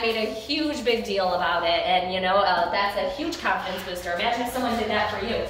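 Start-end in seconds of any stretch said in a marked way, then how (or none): none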